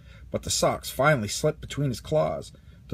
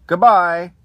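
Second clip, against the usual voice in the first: Andy calling goodbye; 3 semitones higher, 12 dB louder than usual. A narrator's reading. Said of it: "Andy said to his toys".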